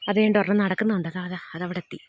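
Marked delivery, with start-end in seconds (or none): 1.1-2.0 soft, excited hush; quietly showing the goat to visitors. Real, not acted. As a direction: point it out under your breath. Quietly pointing out wild goat.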